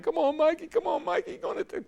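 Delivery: very high, urgent cheering of Mikey toward the line; cheering him on. Retold or imitated. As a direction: urge him home like a fan in the booth. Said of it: "I'm getting all excited".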